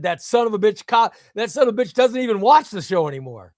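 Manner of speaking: retelling a heated rant about a viewer, mock angry. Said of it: "and I said".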